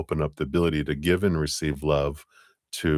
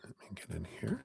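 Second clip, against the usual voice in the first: whisper; 3 semitones higher, 19 dB quieter, much faster than usual. Real, not acted. Aside to herself while setting up.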